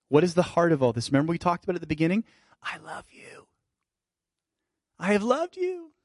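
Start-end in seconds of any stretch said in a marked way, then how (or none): 2.6-3.5 unvoiced tender whisper. God whispering love to his people, tender whisper. 5.0-5.9 tender. Acted. God's words continued.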